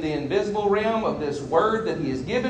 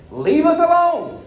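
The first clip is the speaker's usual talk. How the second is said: pleading Israelites; 12 semitones higher, 4 dB louder than usual. Acted. Voicing Israelites begging.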